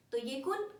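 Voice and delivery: ordinary talk, nothing marked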